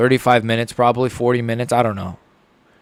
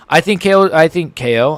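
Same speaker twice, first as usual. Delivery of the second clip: excited; 5 semitones higher, 4 dB louder than usual. Real, not acted. Hyped prediction, shouting.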